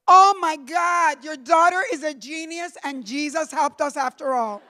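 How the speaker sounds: proud father marvels at the letter, excited and high; father's amazed outburst. Retold or imitated. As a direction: gush like a proud astonished father. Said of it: "my father goes".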